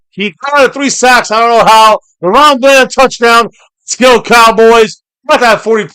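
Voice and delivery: loud, high, triumphant yelling; real celebration shout. Real, not acted. Streamer celebrating a Cowboys pick six with his chat, shouting loud and high. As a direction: scream it like your team just scored.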